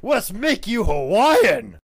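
slow, booming boss proclamation; excited Vince. Acted. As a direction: announce the big idea with relish. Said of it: "Vince's like".

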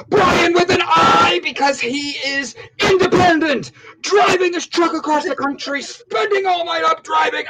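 high, excited call-out; hammed-up hype intro. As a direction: announce it like an overexcited hype man.

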